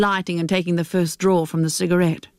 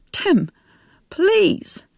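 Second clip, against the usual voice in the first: pleading; 12 semitones higher than usual. Acted. Mother pleading with son.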